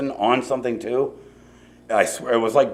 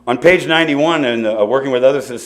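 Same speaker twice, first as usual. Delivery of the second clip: ordinary talk, nothing marked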